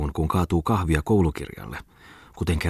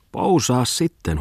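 high, drawn-out teasing call; amused calling out; 7 semitones higher, slower than usual. A narrator's reading. Friends egging Muttinen on, calling out in amusement. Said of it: "huudettiin hänelle kaikkialta nauraen".

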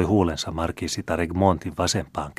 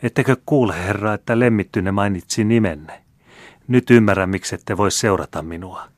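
every word hides a dagger's point; a stinging jealous jab; jealous barbs; 3 semitones higher, 7 dB louder than usual. Acted. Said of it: "virkkoi kätkien jokaiseen sanansa tikarin kärjen".

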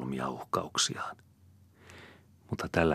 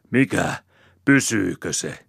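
loud, raised threat; angry challenge; 4 semitones higher, 9 dB louder than usual. A narrator's reading. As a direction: demand it furiously. Enraged man threatens.

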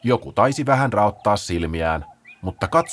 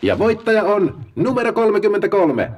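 high, loud, grand announcer voice; host the big draw like a TV lottery show; game-show host; 12 semitones higher, 5 dB louder than usual. Acted. Paula opens the draw for the sloths, grandly.

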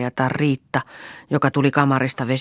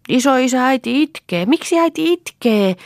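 put-on very high, loud little-girl voice; high-pitched, urgent child asking why; child's worried question; 12 semitones higher, 7 dB louder than usual. A narrator's reading. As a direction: call to grandfather as a puzzled child. Child alarmed that mother is crying.

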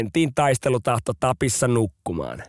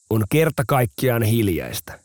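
usual speaking voice

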